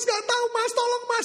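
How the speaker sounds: cling on and beg; desperate plea. Acted. Wife frantically pleading with her husband, high desperate cry.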